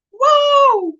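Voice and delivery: high, loud whoa of surprise; surprised whoa. Real, not acted. Delighted at a friend returning to the chat, then a high whoa.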